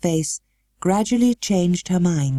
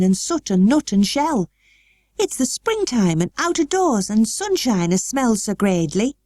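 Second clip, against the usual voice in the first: put-on girl's broadest Yorkshire, higher; 7 semitones higher than usual. A narrator's reading. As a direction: lay the Yorkshire on thick. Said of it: "She said it as broadly as she could".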